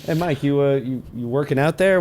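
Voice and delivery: ordinary talk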